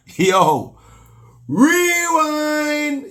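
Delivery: high, held-out Jamaican selector shout of "rewind"; dancehall rewind call. Pretend. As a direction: hype it like a sound-system DJ calling a rewind.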